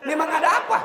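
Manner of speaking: high, loud nosy voice; mocking gossip. Preacher mimicking a nosy gossip, high and loud. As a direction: squeal it like a busybody.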